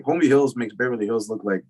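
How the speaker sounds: normal talking voice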